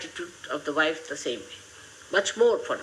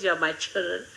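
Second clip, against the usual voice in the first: normal talking voice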